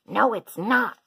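loud, high contradiction; crying out. A narrator's reading. Minosaur arguing over food with Apatosaur, crying out. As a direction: shout it back in protest. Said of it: "cried Minosaur".

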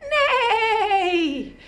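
high wavering whinny; whinny long and high; playful horse cry; horse neigh. Pretend. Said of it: "And what do they say?".

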